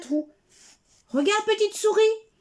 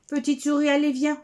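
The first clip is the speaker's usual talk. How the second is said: ordinary delivery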